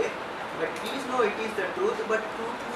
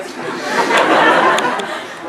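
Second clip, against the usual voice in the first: high, loud, breathless laughter; laughing fit; 11 dB louder than usual. Real, not acted. Narrator cracking up at a friend's speech, loud laughter. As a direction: burst out laughing, can't hold it.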